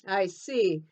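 ordinary delivery

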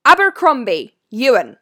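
McGonagall calling out. A narrator's reading. Professor summons the first new pupil, calling out. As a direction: call the name across a hall. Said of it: "called out".

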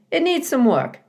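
put-on eager old beaver bargaining. A narrator's reading. Mister Beaver speaks.

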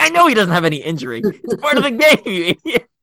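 snapping at the medic, fully fed up; angry. Retold or imitated. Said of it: "Like, so angry".

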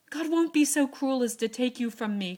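loud defiant protest; rebellious cry. A narrator's reading. Joe protests Beth's fate, loud and rebellious. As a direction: rage against heaven. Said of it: "cried poor Joe rebelliously".

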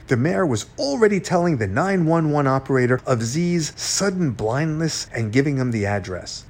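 ordinary delivery

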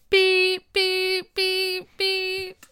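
high, even, monotone beeps; imitated alarm beeps. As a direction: beep steadily like an alarm. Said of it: "My alarm would have gone off".

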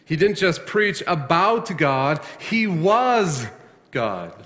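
slowed, then a high lift on was; preacher landing the big point; climactic emphasis. Acted. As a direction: build to the reveal. Climactic sermon line.

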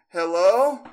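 high, loud rising call; eerie high-pitched hello; little girl voice. Retold or imitated. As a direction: imitate a child calling, closer now. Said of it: "then it comes again, closer".

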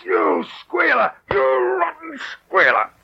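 screamed high with rage; furious shout. Acted. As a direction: scream the insult at the traitor. Henchman screams at betrayer.

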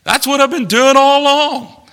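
loud, high protest to God; exasperated protest. Acted. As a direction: raise the voice in complaint.

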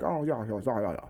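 low, measured well-spoken pupil; put-on formal kid voice; formal schoolboy impression. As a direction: imitate an oddly proper schoolboy, voice dropped. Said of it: "he was talking like, like this".

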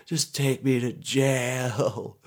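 high, slow wailing surrender; mock sobbing. Caught suspect giving up to police, mock sobbing. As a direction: drag out the words in a hammed-up cry. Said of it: "I'm just sobbing".

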